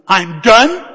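exasperated shout. Retold or imitated. Husband quitting mid-meltdown on phone, exasperated shout. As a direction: yell it like you've completely had it. Acted meltdown, escalating repeat.

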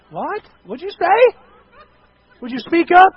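high, loud rising questions; can't-hear-you questioning. Acted. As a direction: call back as if straining to hear. Acted listener who cannot hear.

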